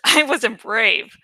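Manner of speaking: usual speaking voice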